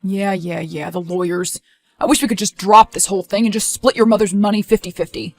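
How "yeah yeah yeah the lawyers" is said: softer, dismissive mutter; dismissive sarcasm. Acted. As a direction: brush it off sarcastically. Dismissive about the lawyers.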